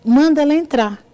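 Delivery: loud, high imitated order to the secretary; calling out. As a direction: call it out to the next room. Doctor calls out to secretary.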